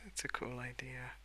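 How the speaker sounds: ordinary talk, nothing marked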